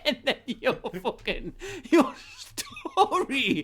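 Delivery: helpless high laughter through the words; laughing hard. Real, not acted. Host cracking up at his own [name] biscuit joke, laughing. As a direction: squeeze it out slowly while cracking up.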